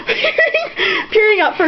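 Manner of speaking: breaking into laughter on the word; laughing. Real, not acted. Reader cracking up at the sad text, laughing. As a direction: giggle the word out high.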